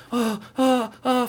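high mock gasping oh; mock panicked kid. Younger son spots the hunted stranger, mock high panic. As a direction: squeal it like a startled boy.

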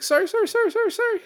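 very high, loud repeated squeal; frantic mock apology. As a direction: squeak it out rapidly as an imitation. Imitates character's frantic apology.

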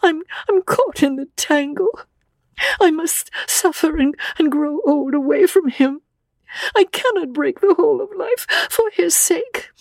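very high, anguished and tearful; despairing girl in tears; sobbing. A narrator's reading. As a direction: sob it out in a high, halting voice. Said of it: "She fell sobbing into the chair".

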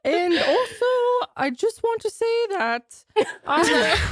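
high-pitched mock Scottish; bad Scottish accent. Player carrying on the accent dare, bad Scottish accent.

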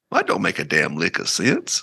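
mock-baffled Southern aside; Southern accent. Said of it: "I've been speaking really Southern lately".

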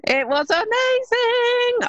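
loud, high, drawn-out cheer; thrilled. Real, not acted. Thrilled answer about first event back.

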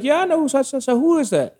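high-pitched mock mom voice; high, retold mother's answer; imitating his mother. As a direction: play mom replying, pitched well up.

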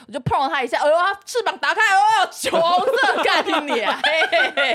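excited shriek while retelling a cockroach scare; panicked retelling. Retold or imitated. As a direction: relive the fright, swearing through laughter. Reliving the cockroach opening its wings.

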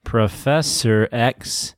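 slow, evenly measured syllables; metrical scansion. Shown as a demonstration. Co-host testing a name for iambs, slow scansion. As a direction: sound out each beat deliberately. Sounding out the meter.

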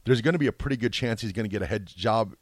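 ordinary talk, nothing marked

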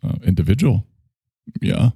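normal talking voice

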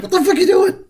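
high, shocked squeal; shocked outburst. Retold or imitated. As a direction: yelp it in sudden disbelief. Guy reacting to being set ablaze.

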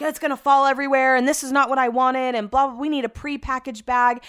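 mocking whiny husband.

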